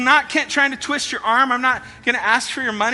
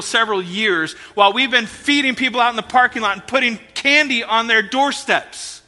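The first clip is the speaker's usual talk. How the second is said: usual speaking voice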